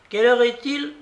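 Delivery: high, loud demand; impatient babouchka. A narrator's reading. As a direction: demand the time in an old-lady voice. Narrator voices the impatient babouchka. (question impatiente de babouchka)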